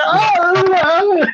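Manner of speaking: high-pitched imitated crying wail; hammed-up bawling; mock wailing. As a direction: wail like a grown man sobbing, mockingly. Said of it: "he's just fucking crying".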